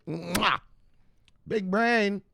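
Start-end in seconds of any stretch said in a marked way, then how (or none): 0.1-0.6 kiss sound. Pretend. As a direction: blow a big playful kiss. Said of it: "Kissing it".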